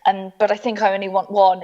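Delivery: ordinary talk, nothing marked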